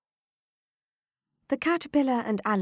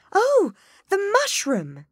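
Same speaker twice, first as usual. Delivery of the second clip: surprised; 8 dB louder, slower than usual. A narrator's reading. Alice's sudden realization.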